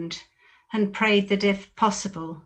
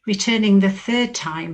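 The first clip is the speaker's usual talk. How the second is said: usual speaking voice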